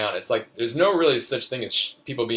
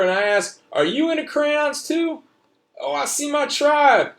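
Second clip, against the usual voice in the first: put-on a young boy telling his adventure, pitched up; child's voice; 8 semitones higher, 5 dB louder than usual. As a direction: read it like an eager little kid. Child narrator reads his story.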